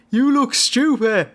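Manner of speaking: put-on lightly done Dublin North Side accent, slow and scolding; a Dublin North Side wrestler mildly scolding the crowd; thick North Side Dublin accent. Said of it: "admonishes the crowd in his thick North Sider accent".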